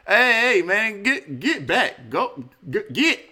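high, loud shooing of a dog; shooing a stray dog. Retold or imitated. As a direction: loudly shoo her off like a mangy stray. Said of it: "treating a stray ugly dog".

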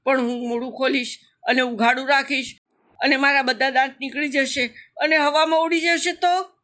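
put-on high, worried questions from a scared child; fearful little croc. A narrator's reading. Little croc fears losing his teeth and frets to mother, high anxious voice. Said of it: "મગરું બીતા બીતા બોલ્યો".

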